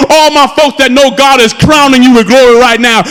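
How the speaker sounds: usual speaking voice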